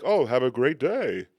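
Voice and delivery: slow, loud, sugary send-off; mock friendliness. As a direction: overdo the cheerful goodbye. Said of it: "You don't be like".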